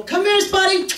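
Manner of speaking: high sweet dog call. Retold or imitated. Dog owner calling the dog at the pound, high and coaxing. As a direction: coax the dog over. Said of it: "then they go".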